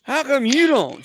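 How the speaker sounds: very high, loud, drawn-out question; mock exasperated squawk at a partner; incredulous challenge. Comedian challenging uninformed wife, high and incredulous.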